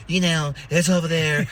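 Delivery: a loose imitation of the orange dude, pointing the way; orange dude impression. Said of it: "I see orange dude".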